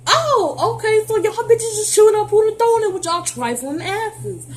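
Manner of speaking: high, scornful mock outrage; high-pitched scornful jab at the trifling girls; mocking disgust. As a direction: sneer at them in a high voice. Mocking the food-throwing girls.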